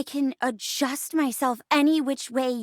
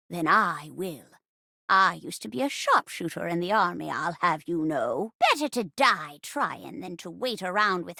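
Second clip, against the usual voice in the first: put-on lower, gritty-willed old sharpshooter; badass grandma; 6 semitones lower than usual. Granny vows to find her husband, tough old-lady voice. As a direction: play a folksy, tough granny on a mission. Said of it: "I adore badass grandma characters".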